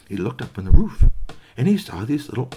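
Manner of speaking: plain reading voice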